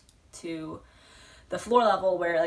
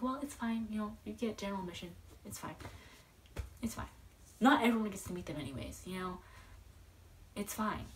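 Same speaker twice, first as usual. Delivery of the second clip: softer, self-soothing murmur; consoling herself; 3 semitones higher, 7 dB quieter, faster than usual. Retold or imitated. Fan consoling herself after the cancelled meet, soft. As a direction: talk yourself down gently, softer. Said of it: "I was trying to console myself".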